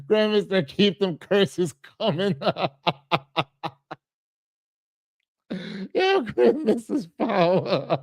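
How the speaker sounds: amused words breaking into real laughter; laughing. Real, not acted. Host teasing a chat member about curses, laughing. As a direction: laugh your way through it.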